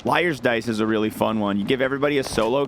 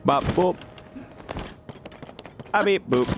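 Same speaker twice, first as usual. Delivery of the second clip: playful high boops; little cartoon robot noises; singsong beeping; 6 semitones higher than usual. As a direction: bip and boop in a bouncy two-note singsong. Singsong beeps while playing game.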